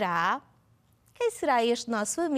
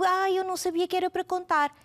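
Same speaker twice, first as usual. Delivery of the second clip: put-on child's whine; 6 semitones higher, faster than usual. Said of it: "meninos que estão a dizer".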